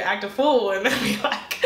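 usual speaking voice

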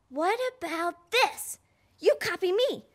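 usual speaking voice

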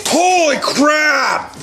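slow, drawn-out high exclamation; amazed shout. Real, not acted. Reacting to a race finish, a drawn-out amazed shout. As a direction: let the amazement stretch out.

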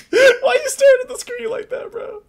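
very high, laugh-choked words; cracking up while talking; helpless laughter. Real, not acted. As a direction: squeal it through laughter. Laughing at staring game character.

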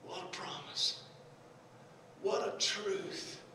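soft, slow near-murmur; quiet awe after the shouting; hushed reverence. Real, not acted. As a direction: let it sink in quietly. Awed hush after preaching.

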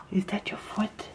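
whispered with a gasp of delight. Real, not acted. Waking toddler from nap.

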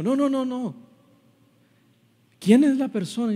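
high, loud acted insistence; agitated manager. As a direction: cut through the reassurances, high and urgent. Upset manager demands who it is. (gerente alterado, insistente, con voz aguda)

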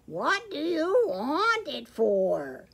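slow, high, loud storybook donkey. A narrator's reading. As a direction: heehaw through the question, drawn out.

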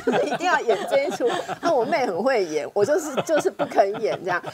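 amused, laughing through the words; laughing while talking. Real, not acted. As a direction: laugh as you tell it.

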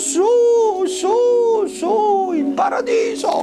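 high, slow squeaky angel puppet; squeaky shrill puppet voice. Acted. Angel calling Andreino up.

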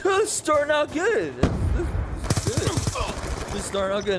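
very high mock-cheerful squeal; high-pitched ironic commentary; sarcastic self-quote. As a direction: squeal it sarcastically, easing down at the end. Sarcastic quote of own reaction.

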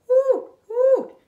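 loud hooting owl; a big owl scolding. Acted. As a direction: hoot it out big. Owl says magic word.

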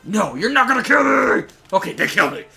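high, loud panicked scream; defiant yell. Real, not acted. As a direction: shriek in protest as you get killed. Yells while being killed in game.

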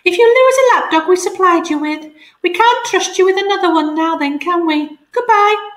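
a prim bird on the phone reciting policy; cockatoo voice. Acted. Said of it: "the cockatoo was saying".

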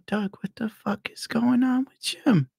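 slow, hushed mock whisper; acted baffled whisper to a friend; urgent stage whisper. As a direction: whisper it like a confidential aside. Pulls friends aside to ask.

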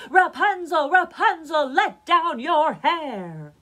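loud, high call up to the tower; crying out. A narrator's reading. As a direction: shout it upward, stretching the final words. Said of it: "went to the tower and cried".

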